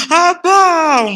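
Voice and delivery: high, loud tearful wail of Abang; wailing cry. Acted. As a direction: cry out to the husband in tears. Wife wails, crying louder. (isteri meraung memanggil suami)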